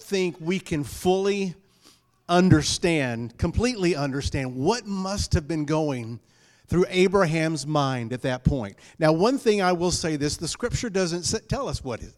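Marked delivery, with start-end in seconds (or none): none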